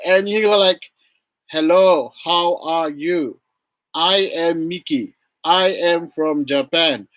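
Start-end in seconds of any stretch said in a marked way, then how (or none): 1.5-7.1 slow, word-by-word recital; mock a stilted beginner's self-introduction; textbook English. Teacher mimicking textbook introductions nobody uses, stilted. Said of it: "nobody does speak like that".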